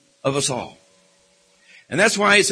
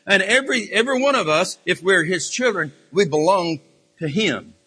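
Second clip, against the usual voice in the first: ordinary talk, nothing marked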